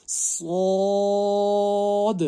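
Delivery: سواد chanted long and thick, high and loud; teacher modelling a thick صاد; heavy stretched letter. Shown as a demonstration. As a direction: recite سواد heavy and drawn out. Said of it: "موٹا پڑھنا ہے".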